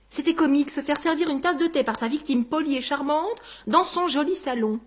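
plain reading voice

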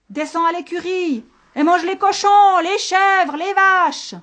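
high, loud, panicked command; desperate shout. Acted. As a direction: shout it high to send the beast away. Shepherd desperately shouts at monster. (cri affolé et aigu)

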